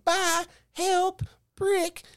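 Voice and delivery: put-on very high squeaky toddler words; toddler's squeaky high voice. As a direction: squeak each word up an octave. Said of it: "his voice goes up on all of his words".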